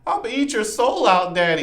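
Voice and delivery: high-pitched daughter imitation; mock little-girl plea hiding menace; innocent crying girl. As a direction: threaten like an innocent, pleading child. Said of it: "this girl that's crying for help".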